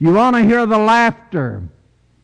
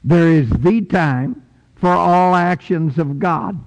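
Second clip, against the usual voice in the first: usual speaking voice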